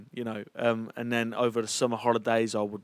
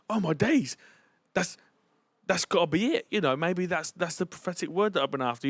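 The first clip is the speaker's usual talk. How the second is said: higher-pitched, amazed realisation; retold amazement; 7 semitones higher than usual. Recalling hearing the prophetic word, amazed. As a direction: relive the moment it clicks.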